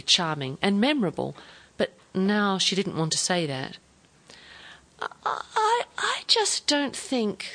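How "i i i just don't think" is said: slow, much higher, halting stammer; a higher, hesitant voice stumbling on its start; uncertain. A narrator's reading. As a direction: falter over every word, unsure. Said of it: "said Joyce uncertainly".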